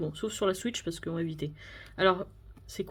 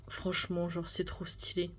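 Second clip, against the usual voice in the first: awed whisper; 3 semitones lower, 8 dB quieter than usual. Real, not acted. Overwhelmed by card.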